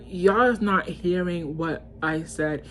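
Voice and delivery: usual speaking voice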